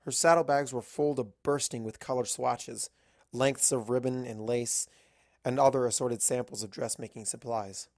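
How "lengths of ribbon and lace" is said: ordinary delivery, nothing marked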